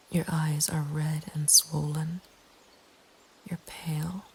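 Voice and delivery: ordinary delivery, nothing marked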